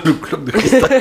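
slow, high words through laughter; laughing. Real, not acted. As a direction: giggle through the name. Repeating the prize name, amused.

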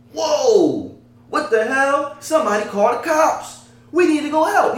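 high, loud mock alarm; alarmed calling out. Voicing what the man with the camera never yelled, alarmed outcry. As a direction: act out a bystander urgently calling for help. Acts out missing bystander alarm.

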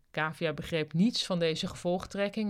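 ordinary delivery

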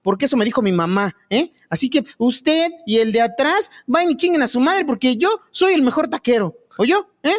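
cocky street vendor taking offense; defensive taco seller. Acted. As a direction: argue back at your critics, stubbornly proud. Taco seller argues with critics.

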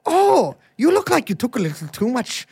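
high, lilting mock-Irish; badly faked Irish leprechaun at a party; Lucky Charms leprechaun. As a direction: open with high Irish oohs, then coax. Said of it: "do the Lucky Charms voice".

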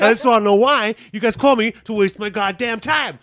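blowing up at the clerk; furious customer yelling at the clerk; angry demand. Retold or imitated. Ranting at phone clerk.

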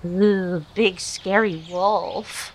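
slow, drawn-out mock fear. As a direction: tease her by pretending to be scared. Mocking the werewolf.

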